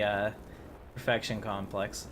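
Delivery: ordinary talk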